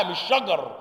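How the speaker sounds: usual speaking voice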